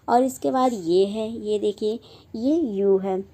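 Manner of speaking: ordinary talk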